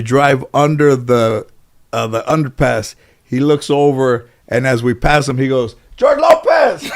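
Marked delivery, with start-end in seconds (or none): 6.0-6.9 high-pitched holler of a passerby; imitated yell. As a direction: call the name out like a stranger yelling from afar. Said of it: "Did that guy yell George Lopez".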